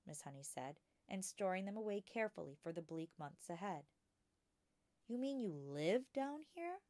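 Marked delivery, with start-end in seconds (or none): 5.1-6.8 put-on higher, eager little girl's voice; a bright young girl asking her teacher; young Matilda. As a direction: ask like a curious small girl. Matilda's childlike voice.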